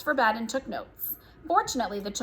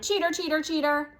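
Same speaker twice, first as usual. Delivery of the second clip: put-on higher, childish taunting cry; shouting; 5 semitones higher than usual. A narrator's reading. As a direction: chant it as a mild mock shout. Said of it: "kicked at the door and shouted".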